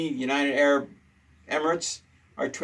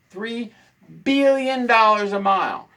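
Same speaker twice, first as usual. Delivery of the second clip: Three pitched high, then billion said loud; outraged emphasis on the price; stress on Three; 6 semitones higher, 5 dB louder, slower than usual. Real, not acted. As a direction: hit "Three" then land "billion dollars a mile" hard. Outrage at cost.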